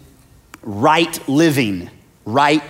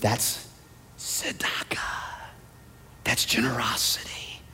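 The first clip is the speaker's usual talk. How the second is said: unvoiced hushed reveal; whisper; 3 semitones lower, 14 dB quieter than usual. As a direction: whisper the answer reverently.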